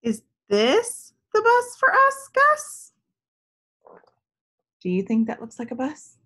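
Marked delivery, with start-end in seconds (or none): 0.0-2.9 put-on high, bright hopeful question, a child's voice; little girl hoping each vehicle is her bus; eager little girl. Girl Tess asks in story.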